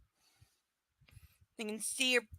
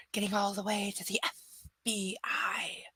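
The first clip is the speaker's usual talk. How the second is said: whisper; 5 dB quieter than usual. A narrator's reading. Dramatic reading of story line.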